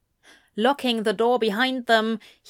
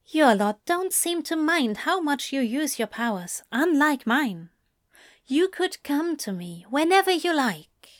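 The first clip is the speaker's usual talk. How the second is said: higher-pitched angel, pleading his case; earnest angel; 6 semitones higher than usual. Acted. Angel talking demon into coming to him, high and earnest. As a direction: persuade in a high, mild angel voice.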